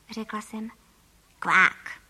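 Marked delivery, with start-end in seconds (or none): 1.4-2.0 loud, low quack; duck quack. A narrator's reading. Transformed boy answers the telephone, duck quack. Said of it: "odpověděl mi na druhém konci drátu nějaký hlas".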